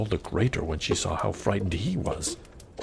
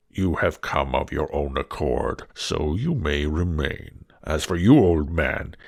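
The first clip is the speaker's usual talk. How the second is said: low beast voice rising louder at the merchant; commanding beast; 3 semitones lower, 4 dB louder than usual. Acted. Beast orders the merchant away, low then loud. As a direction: lay down the law like a monster.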